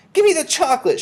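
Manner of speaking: very high, loud bratty demand; boy's shout. A narrator's reading. Suspicious Bruno demands chocolate, shouting high. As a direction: yell it as a suspicious boy. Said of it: "shouted Bruno".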